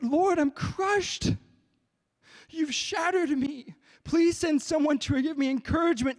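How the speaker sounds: high, anguished cry to God; retold wail of a crushed man praying; loud crying plea. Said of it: "I'm crying so loud".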